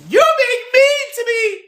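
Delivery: high, loud whiny mock voice; hammed-up whiny victim voice; mock whine. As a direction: squeal it like a hurt child. Mock whiny quote of others.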